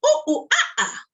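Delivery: very high, loud monkey calls; monkey hoots. Shown as a demonstration. Storyteller showing kids the monkey noise, high hoots. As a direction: hoot like a playful monkey. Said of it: "can you go".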